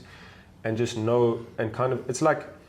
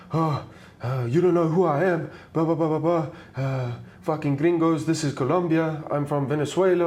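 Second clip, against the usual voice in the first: mock threat; 5 semitones higher than usual. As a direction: imitate a threatening man, half-hearted. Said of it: "he's like".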